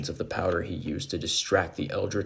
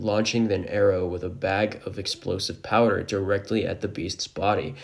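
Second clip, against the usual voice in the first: ordinary delivery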